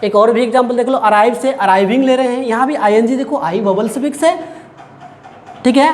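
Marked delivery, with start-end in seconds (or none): none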